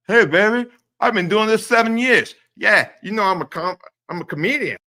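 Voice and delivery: high, loud smarmy comedian voice; Mersh impression. Doing his impression of Mersh for the chat, high and loud. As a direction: ham up a sleazy self-styled comedian. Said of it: "this is my real Mersh impression".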